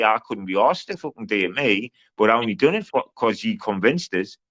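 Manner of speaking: normal talking voice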